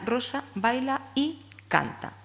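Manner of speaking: slow, each word set apart, Rosa, baila y canta. Shown as a demonstration. Teacher counting words for the child, slow and separated. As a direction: dictate the four words slowly. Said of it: "Tenemos que llegar a".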